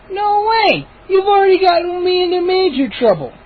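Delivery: very high, tearful refusal; outraged sister. Acted. Mindy refusing the gnomes. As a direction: refuse flatly, near tears.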